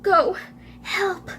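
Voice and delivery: broken words with pauses, fading to breath; hammed-up last gasp, word by word; acted dying plea. Collapsing man asks for help, acted failing voice.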